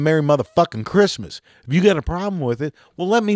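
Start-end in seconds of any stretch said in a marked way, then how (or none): 0.0-1.4 defiant greeting. Pretend. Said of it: "express my joy ... onto you by saying".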